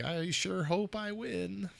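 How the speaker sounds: put-on high, slow, soft old-lady voice; grandmother voice. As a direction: quaver it out slowly like a hopeful granny. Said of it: "Is that your grandmother voice?".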